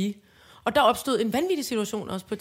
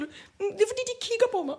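put-on very high, whimpering child voice; teacher voicing a sobbing girl blaming the boys; little girl; 11 semitones higher than usual. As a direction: complain like a tearful small girl. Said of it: "så siger hun".

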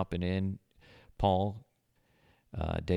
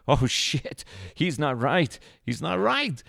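loud, high mock panic, rising on the repeat; hammed-up alarmed report; retold alarm; 8 semitones higher, 6 dB louder, slower than usual. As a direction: blurt it out like a rattled apprentice, getting higher. Apprentice's alarmed report in retold story.

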